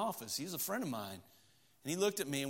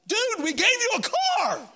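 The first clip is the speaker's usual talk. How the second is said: high, loud, laughing outburst; exasperated shout; 9 dB louder than usual. Retold or imitated. At the ungrateful family, laughing, shouting in disbelief. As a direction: blurt it out like you can't believe them. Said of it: "shake him like".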